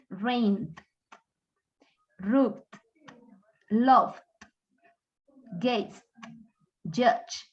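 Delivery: "rained, rubbed, loved, gazed, judged" one at a time, each closed on D; voiced D ending. Shown as a demonstration. Teacher drilling voiced past endings, voiced D ending. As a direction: say each verb as one syllable with a final D. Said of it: "sacamos la lengüita".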